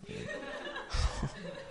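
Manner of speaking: soft, very high-pitched laugh; high giggle. Real, not acted. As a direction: let a small high laugh escape. Laughing at own retold reaction.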